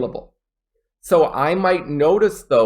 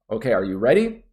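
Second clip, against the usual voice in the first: normal talking voice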